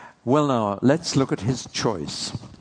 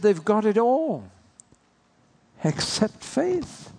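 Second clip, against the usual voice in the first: Paul's lament; 8 semitones higher than usual. Retold or imitated. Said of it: "And he said".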